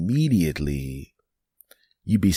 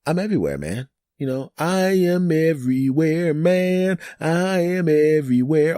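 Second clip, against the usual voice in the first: boastful brag; 10 semitones higher, 3 dB louder than usual. Real, not acted. Boasting about podcast reach.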